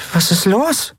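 high, tiny puzzled voice; confused. A narrator's reading. Tiny Nelen asks her panicking friend, high and confused. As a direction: pipe up in bewilderment. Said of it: "fragte Nelen verwirrt".

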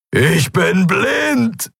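high, drawn-out acted yell; bellowing. A narrator's reading. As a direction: roar it slowly, rising high. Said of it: "brüllte er". (langgezogen und hoch gebrüllt)

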